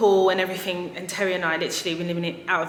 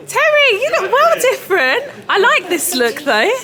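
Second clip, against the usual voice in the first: much higher and louder, gushing greeting; delighted shout; 13 semitones higher, 12 dB louder than usual. Real, not acted. Woman greeting the salesman Terry, delighted and loud. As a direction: greet him with big delight.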